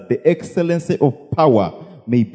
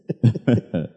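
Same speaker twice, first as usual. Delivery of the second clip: soft, low chuckle; low little chuckle to oneself; 4 semitones lower, 5 dB quieter than usual. Real, not acted. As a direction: give a short, quiet laugh. Preacher chuckles after his point.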